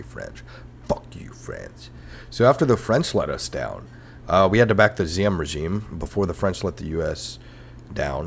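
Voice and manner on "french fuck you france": very low, hushed mock menace; quiet, drawn-out insult; muttered contempt. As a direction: sink to a slow, low mutter. Rant sinks into muttered insult.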